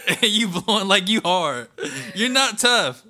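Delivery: high, loud laughing mockery; cracking up while roasting them. Real, not acted. Mocking hookah smokers, laughing.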